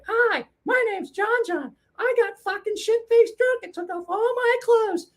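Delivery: very high, slow cartoon puppet voice; John John puppet. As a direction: squeak it out as a silly talking puppet. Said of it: "videotaped us with the markers going".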